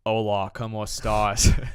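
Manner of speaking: slow, heavy gringo Spanish; Spanish in a thick American accent. Retold or imitated. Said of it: "I'd be like".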